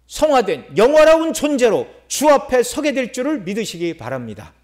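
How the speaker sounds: high, emphatic proclamation with stretched key words; full-force preaching. Real, not acted. Preacher assures congregation of full cleansing, full-force proclamation. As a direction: proclaim the promise with conviction.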